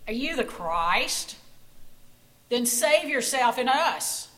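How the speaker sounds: loud mocking challenge to a dying man; jeering taunt. A narrator's reading. Korath taunting Jesus on the cross, loud jeer. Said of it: "It was Korath saying".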